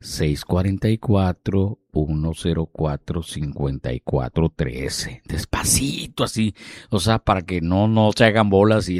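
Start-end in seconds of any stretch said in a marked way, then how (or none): 5.3-6.6 soft whispered demonstration. Shown as a demonstration. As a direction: breathe the word out gently. DJ demonstrating slow speech.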